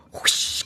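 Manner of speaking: soft whooshing sound; whispered whoosh. Pretend. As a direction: push the vibes out with breath. Sending labor vibes again.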